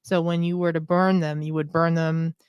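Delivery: ordinary talk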